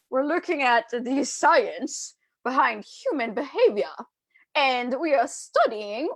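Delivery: slow, hammed-up British accent; terrible British accent. Coach on science behind human behavior, badly faked British accent. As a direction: attempt a bad, drawn-out British accent. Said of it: "that's a terrible, terrible, terrible, um, attempt at a British accent".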